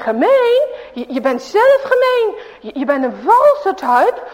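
high, loud brave retort; little boy finally talks back; storyteller's mock angry child; daring outburst. A narrator's reading. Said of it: "dan durft Ahmed zomaar opeens".

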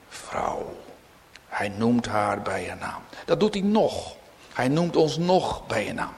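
ordinary delivery

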